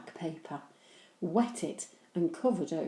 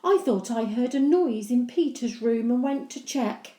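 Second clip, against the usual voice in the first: innocent; 6 semitones higher, 7 dB louder than usual. Acted. Henry lies to Mum.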